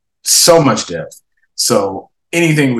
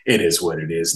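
ordinary delivery

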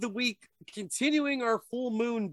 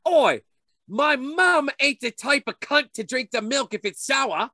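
loud, higher mock Cockney British accent; 6 semitones higher, 6 dB louder than usual. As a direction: bark it like a rowdy British lad. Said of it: "probably the British version".

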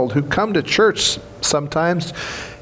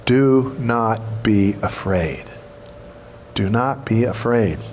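gentle angel's comfort; 5 semitones lower than usual. Acted. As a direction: lower and slow down, reassure them like the angel. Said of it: "And he said".

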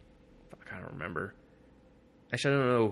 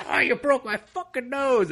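much higher, loud nasal-free whimper of pain; whiny mock voice; 7 dB louder than usual. Beaten inmate complains to the fighter, high whiny mock cry. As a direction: whine like your nose just got busted. Guy with broken nose, retold.

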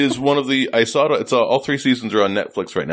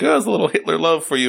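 higher, quick, pleased-with-himself voice; retelling a Peep Show scene, mock giddy higher voice; clueless character quote; 7 semitones higher, faster than usual. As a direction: gush it out like an oblivious sitcom character. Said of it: "and he's like".

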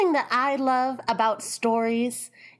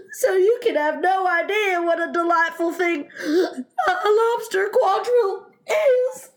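high, wailing, broken by gulping sobs; tearful sobbing; 11 semitones higher, 4 dB louder than usual. Acted. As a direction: cry through it, rising into a wail. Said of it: "with tears running down his cheeks".

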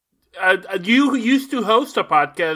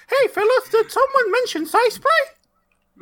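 chipmunk voice. Pretend. Joins the tiny-voice bit.

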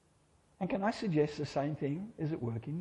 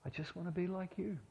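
higher, very soft and clear; small fragile voice; 6 semitones higher, 10 dB quieter than usual. Acted. Inner voice answering the Lord, small and fragile. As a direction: fragile as a spark. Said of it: "that same small, clear voice, fragile as a spark".

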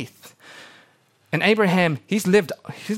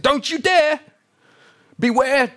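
much higher, louder alarmed outburst; Abraham freaking out; 12 semitones higher, 5 dB louder than usual. Retold or imitated. Abraham forbidding his servant, a high alarmed shout. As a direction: cry out in horror as old Abraham. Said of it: "Abraham kind of freaks out".

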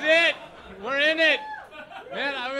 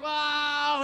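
amazed wow; 8 semitones higher than usual. Pretend. Ship explodes behind them.

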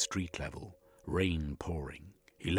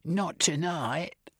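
high, drawn-out alarmed refusal; high, urgent protest; fearful cry; 11 semitones higher, much slower than usual. A narrator's reading. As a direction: cry out in fear, stretching the words. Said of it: "Missus cried".

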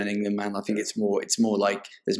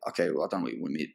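flustered mumble; 5 semitones lower, 9 dB quieter, much faster than usual. Retold or imitated. Nervous reply upstairs.

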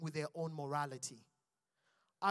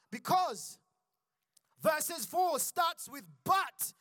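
rising excitement; 6 semitones higher, 6 dB louder, slower than usual. Real, not acted. Preacher building the congregation up toward the word but. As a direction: climb higher and louder toward but. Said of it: "building to the big but".

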